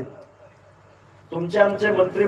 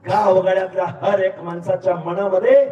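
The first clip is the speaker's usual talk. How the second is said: usual speaking voice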